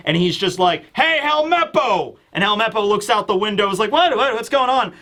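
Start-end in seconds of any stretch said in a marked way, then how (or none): 0.9-2.2 high-pitched mock yell, no real volume; yelling over. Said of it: "he yells over". 3.9-4.9 high, mock-bewildered questions; startled confusion. Acts startled Helmeppo at the window.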